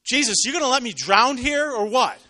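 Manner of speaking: loud, high-pitched exasperated yell; acted outburst of indignant alarm; upset, demanding shout. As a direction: yell it out, fed up and wound tight. Upset man demanding of Jesus.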